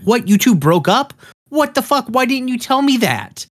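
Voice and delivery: high-pitched incredulous questioning; mock shock. Kevin learns Steven and Connie broke up, mock shock. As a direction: act out disbelief with the voice shooting up.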